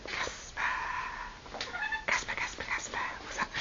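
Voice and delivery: breathy whispered calling of a name. Real, not acted. Owner coaxes her bird Casper, whispered. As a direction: hush it, coax the bird closer.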